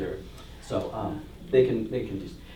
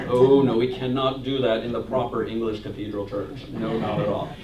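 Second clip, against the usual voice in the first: lightly voiced prim English cleric, lower; mock stuffy churchman; 5 semitones lower than usual. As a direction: sniff at it like a proper cathedral canon. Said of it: "Didn't like".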